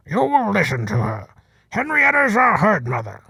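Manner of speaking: hint of a bull warning the old one; young bull defending the leader; Brutus's challenge. A narrator's reading. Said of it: "Brutus bleated out a challenge".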